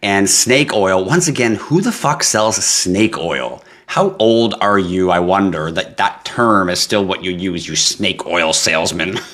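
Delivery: usual speaking voice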